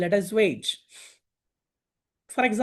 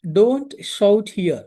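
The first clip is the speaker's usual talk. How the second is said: slow teaching read; 5 dB louder, much slower than usual. Shown as a demonstration. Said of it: "చిన్నగా మాట్లాడు (phrase being taught)".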